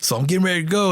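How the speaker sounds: normal talking voice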